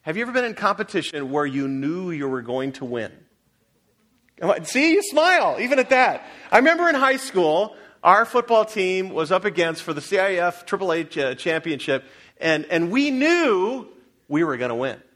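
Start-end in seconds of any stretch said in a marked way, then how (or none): 4.4-15.0 rousing preaching. Acted. Preacher telling the congregation about the championship, rousing and high. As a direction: preach it like the winning team.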